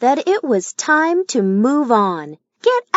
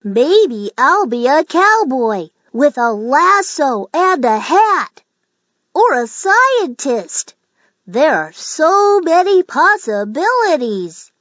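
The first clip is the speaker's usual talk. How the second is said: rising higher with eager wonder; excited daydreaming; 6 semitones higher than usual. Acted. Ollie dreams of becoming a cowboy or scientist, excitedly. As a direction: dream big, getting more thrilled. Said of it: "he thought with excitement".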